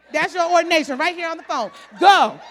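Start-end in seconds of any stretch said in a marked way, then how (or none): 2.0-2.4 loud one-word command. Pretend. God sending the believer, loud command. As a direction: send them out with one word.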